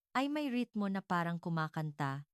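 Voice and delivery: ordinary delivery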